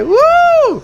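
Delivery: high, loud long "woo"; crowd cheer. Retold or imitated. Studio crowd awaits Katie Holmes, loud cheering whoop. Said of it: "the crowd cheering all that".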